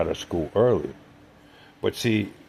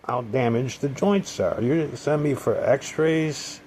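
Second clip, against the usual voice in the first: retold argument; 8 semitones higher than usual. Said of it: "I says".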